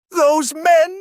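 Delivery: high, cracked cry of grief; sobbing. A narrator's reading. Alina sobs to Eli about the men. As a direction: sob it out, barely holding together. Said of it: "she sobbed".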